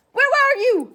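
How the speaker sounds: loud high yell at the dog. Acted. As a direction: yell it out, upset and relieved. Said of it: "I yelled".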